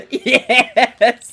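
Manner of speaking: high, loud gleeful cry; cheering. Real, not acted. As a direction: cheer it out delighted. Player cheers laughing.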